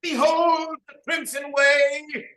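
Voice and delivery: slow, high, loud proclamation; ritual shout. Acted. Nabalac summoning the imaginary herd, slow and booming. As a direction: call out the way like a prophet.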